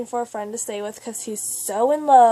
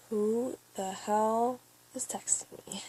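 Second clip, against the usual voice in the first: whispered, slow grumble to oneself; hushed retelling of private irritation; retold whisper; 9 dB quieter, slower than usual. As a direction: whisper it slowly, as if puzzled by the phone. Muttering at late-night phone alert.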